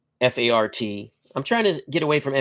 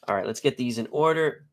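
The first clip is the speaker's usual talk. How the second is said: ordinary talk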